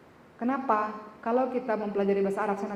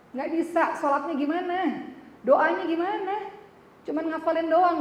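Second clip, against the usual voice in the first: higher and louder, pressing the point; emphatic preaching; 8 semitones higher, 3 dB louder than usual. Acted. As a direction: challenge the congregation with rising heat. Preacher repeats it for force.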